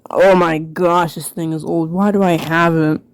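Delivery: normal talking voice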